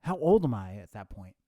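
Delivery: ordinary talk, nothing marked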